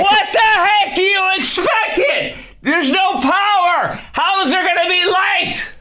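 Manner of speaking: very high, drawn-out incredulous squawk; hammed-up outraged disbelief, slow and high; exasperated yelling. Host answering woman's power complaint, exasperated squawk.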